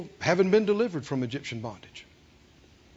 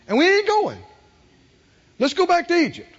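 high, defiant refusal; acted mutinous declaration; 9 semitones higher, 5 dB louder than usual. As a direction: push back as the stubborn Israelites. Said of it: "they had repeatedly said defiantly to him".

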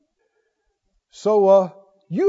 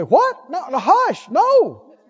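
high, louder mock-startled no; playfully shocked rejection of doom talk; startled refusal; 9 semitones higher, 5 dB louder, much slower than usual. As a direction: brush off bad news with a laughing shocked no. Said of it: "You'd say".

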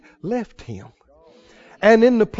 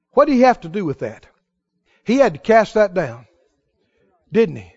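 ordinary talk, nothing marked

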